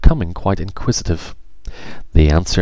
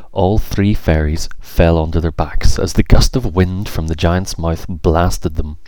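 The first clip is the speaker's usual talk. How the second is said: plain reading voice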